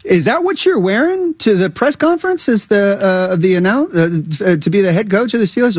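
high-pitched incredulous question; questioning relative. Acted. Relative asks coach stepdad about his outfit, questioning.

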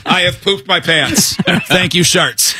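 high, slow, lightly hammed-up confession; mock pitch contestant. Host playing contestant closing the pitch, mock pitch. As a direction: pitch it up and space it out like a presenter.

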